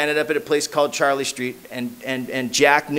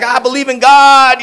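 much higher, louder and slow, hammed-up zeal; earnest believer; 9 semitones higher, 11 dB louder, slower than usual. Sponsor declaring his faith, mocked slow, high and loud. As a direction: lay the faith on thick, slowly. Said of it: "He's like".

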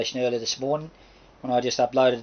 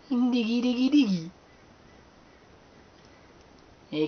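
coaxing pet birds; 10 semitones higher, 5 dB louder than usual. Real, not acted. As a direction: coo at the birds, high and sweet. Baby talk to the birds.

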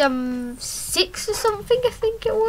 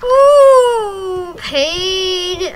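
mock pain groan; 5 semitones higher, 7 dB louder than usual. Mock hurt at crash.

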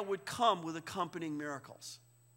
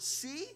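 "see" raised in pitch; stressing the word; 6 semitones higher than usual. Shown as a demonstration. Contrasting two Greek words for the class, "see" raised. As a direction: lift "see" to set it apart. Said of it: "So the word".

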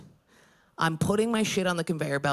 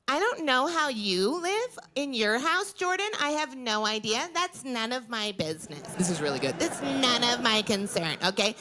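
impression of my sister; 5 semitones higher than usual. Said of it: "I'm gonna do an impression of her".

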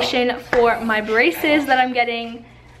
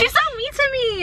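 mock whine; 12 semitones higher than usual. Mock complaint to mom.

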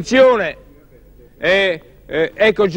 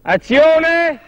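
calling action; 9 semitones higher, 4 dB louder, much slower than usual. Real, not acted. Director calling action again.

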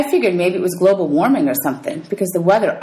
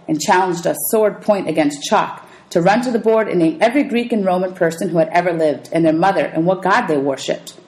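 ordinary delivery

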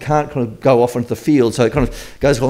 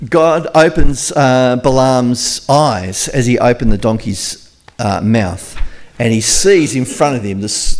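normal talking voice

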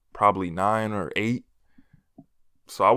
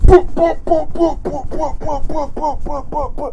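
imitated beating sound; 8 dB louder than usual. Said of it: "My mama said".

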